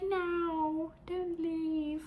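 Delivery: high, pleading mock Australian; Australian accent. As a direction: plead in a badly faked Aussie accent. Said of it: "trying to do the Australian accent".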